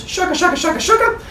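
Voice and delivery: put-on high squeaky baby shaking rattles; baby rattle noise.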